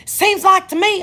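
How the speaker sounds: high, loud cutting-in; hollered retort. Retold or imitated. Phone quarrel before hanging up, loud high retort. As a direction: holler it over the other person.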